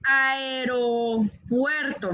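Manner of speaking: "Aeropuerto" drawn out very slowly, a-e-ro-puerto; slow teacher's model of "Aeropuerto"; careful aeropuerto. Shown as a demonstration. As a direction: stretch each part of "Aeropuerto". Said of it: "la forma es aeropuerto".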